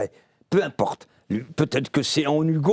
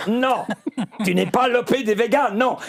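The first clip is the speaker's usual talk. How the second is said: laughing; 7 semitones higher than usual. Real, not acted. Laughs while retelling the séance.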